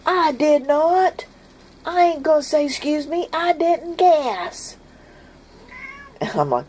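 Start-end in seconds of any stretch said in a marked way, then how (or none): 0.1-4.8 put-on indignant boy. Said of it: "The boy's like".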